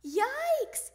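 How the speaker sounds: high, loud, startled cry; yelp. A narrator's reading. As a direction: yelp as if suddenly stung. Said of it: "he yelled".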